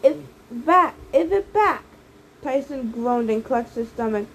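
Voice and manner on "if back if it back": raised, higher mock yell; a narrator's shouting old woman. A narrator's reading.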